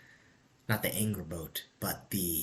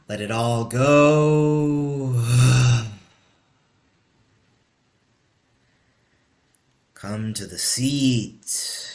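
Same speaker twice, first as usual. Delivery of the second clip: slow, drawn-out breathing guidance, louder and higher; guided meditation; 3 semitones higher, 9 dB louder, much slower than usual. Acted. Teacher guiding the group into breath, slow meditation voice.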